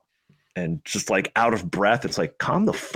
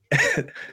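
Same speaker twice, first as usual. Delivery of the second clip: high laugh. Real, not acted. Laughs at clip.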